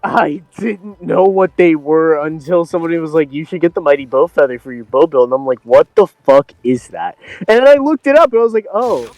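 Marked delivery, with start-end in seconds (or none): none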